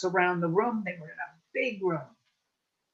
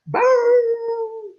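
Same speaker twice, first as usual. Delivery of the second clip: high, loud yelping bark attempt; a clumsy coyote trying to bark; failed bark; 9 dB louder than usual. Coyote practicing his bark, a high loud yelp. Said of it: "he practiced barking".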